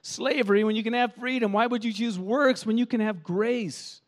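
high, baffled pleading; perplexed Paul. Retold or imitated. Paul pleads with the Galatians, high and baffled. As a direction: plead in disbelief. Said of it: "Paul is saying".

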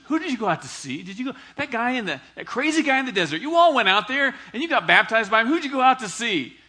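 rally the crowd, laughing at the idea; playful excited Jesus. Acted. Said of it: "He's smiling, he's laughing".

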